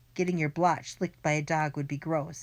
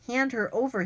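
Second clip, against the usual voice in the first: a higher, raised demand, not a full scream; half-scream the order; mild mock scream; 7 semitones higher than usual. A narrator's reading. Villain demanding the dog, mild mock scream. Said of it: "he screamed".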